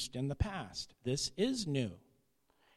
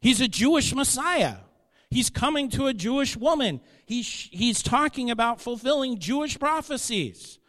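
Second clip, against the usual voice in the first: higher and louder, pressing each point; fervent preaching; 8 semitones higher, 6 dB louder than usual. Real, not acted. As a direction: drive it home from the pulpit. Preacher building emphasis.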